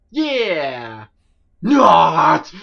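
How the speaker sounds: a loud jeering NOT; mocking shout. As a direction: bellow it like a taunt.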